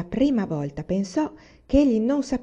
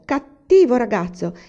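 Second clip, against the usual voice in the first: louder, slow scolding; mother scolding her son, slow and loud; scolding exclamation; 4 semitones higher, 8 dB louder than usual. Acted. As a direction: tell the naughty boy off sharply. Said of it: "esclamò".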